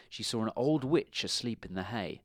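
usual speaking voice

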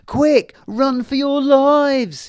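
high, loud, slow urgent cry; panicked little fairy shouting run; fairy shouting a warning. Acted. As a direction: yell it out to warn the whole wood. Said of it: "he shouted".

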